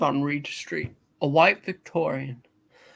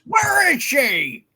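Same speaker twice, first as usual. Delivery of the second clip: slow, loud, higher demand; desperate shout; 8 semitones higher, 5 dB louder, much slower than usual. Acted. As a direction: bellow it out in panic. Husband demands to know where wife is.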